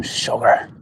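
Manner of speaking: a whispered sugar under the breath; muttered curse. Real, not acted. Hit by a windy mic, a whispered curse. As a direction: mutter it to yourself.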